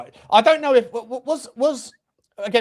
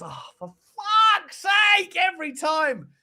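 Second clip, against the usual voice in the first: retold fan's despair; 11 semitones higher, much slower than usual. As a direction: howl it like the goal just went in. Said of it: "And it's just".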